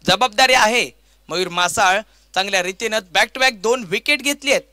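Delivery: ordinary delivery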